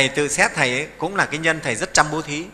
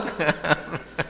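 short laugh; 5 dB quieter than usual. Real, not acted. Monk laughs at own joke.

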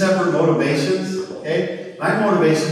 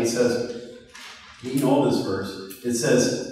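ordinary talk

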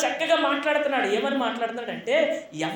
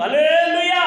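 raised, ringing hallelujah; loud hallelujah; 5 semitones higher, 6 dB louder, slower than usual. Real, not acted. Preacher rousing the congregation, loud hallelujah. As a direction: call it out to the congregation. Rousing shout, later contrasted soft.

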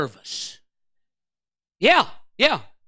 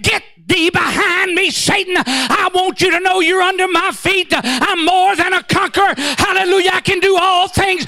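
defiant rebuke; 6 semitones higher than usual. Retold or imitated. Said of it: "I said".

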